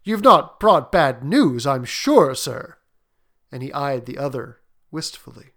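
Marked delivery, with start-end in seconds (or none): 0.0-2.8 anxious reassurance. A narrator's reading. Husband calming wife, pleading.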